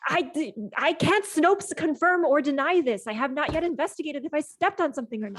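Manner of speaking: stumble, then insist officiously; indignant character covering himself; defensive. Acted. Acted character defensively denies an accusation.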